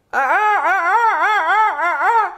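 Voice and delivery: high, loud startled yelps; mock startled gasps. Wax figure suddenly coming alive, yelping high and loud. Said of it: "And then I'll be like".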